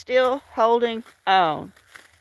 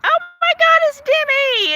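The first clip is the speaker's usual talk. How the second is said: excited greeting; 13 semitones higher than usual. Real, not acted. Spotting a friend join the stream.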